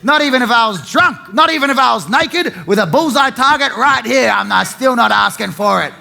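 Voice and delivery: loud, hammed-up Aussie bloke protesting; mock bloke shout. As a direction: belt it out like an offended bloke. Mock bloke ranting about consent.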